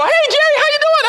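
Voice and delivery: high, loud gushing phone hello; chatty caller. Retold or imitated. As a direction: greet him like an overexcited talker on the phone. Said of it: "they go".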